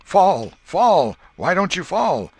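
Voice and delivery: high yelling demand, very loud; shouting. Acted. Tom shouting at Joe mid-swordfight, demanding yell. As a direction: yell at him to fall down. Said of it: "By and by Tom shouted".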